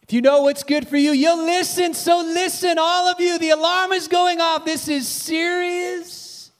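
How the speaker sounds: high, loud commanding call to the city; thunderous divine warning; God calling out. Acted. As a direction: warn the whole city from above. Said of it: "God calls out to the city".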